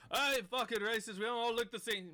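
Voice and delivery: offended yell. Acted. Offended caravan member yelling at the party, loud and high.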